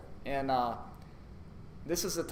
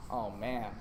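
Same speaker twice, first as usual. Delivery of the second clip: low, quiet acted groan of dread; hushed, mildly dismayed aside; muted dismay; 5 semitones lower, 8 dB quieter than usual. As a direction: sink into a soft, resigned worry. Dreads the approaching stranger.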